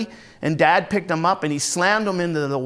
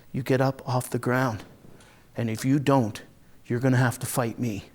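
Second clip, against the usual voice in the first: low, softened, controlled menace; quiet threat; 5 semitones lower, 5 dB quieter than usual. Acted. Brother threatens Les curled on the ground, quiet low threat. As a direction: lay down an ultimatum, low and quiet.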